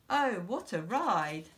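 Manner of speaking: ordinary delivery, nothing marked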